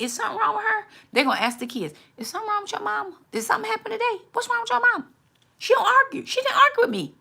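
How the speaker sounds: high, quick puzzled voice; baffled spouse. Retold or imitated. Confused husband puzzling over his calm wife, high and bewildered. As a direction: wonder aloud what's gotten into her. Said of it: "they gonna be like".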